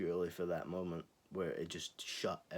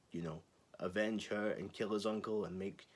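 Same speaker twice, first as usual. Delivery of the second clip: ordinary talk, nothing marked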